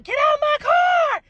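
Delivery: high, loud scream through laughter; frantic shriek at the thief, slow at the end. Retold or imitated. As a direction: yell at her to get out of the car.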